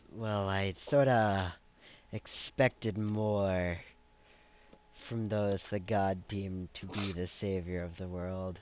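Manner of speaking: unimpressed dragon. Acted. Dragon sizing up the chosen heroes, slow and underwhelmed. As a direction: draw it out with measured disappointment. Game master voices Karath.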